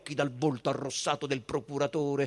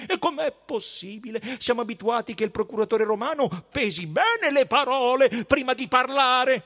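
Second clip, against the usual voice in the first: high, emphatic priestly voice, rising in force; affronted high priest; 11 semitones higher, 5 dB louder than usual. A narrator's reading. Caifa challenges the procurator's threat, high and indignant. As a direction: rebuke from on high, leaning on key words.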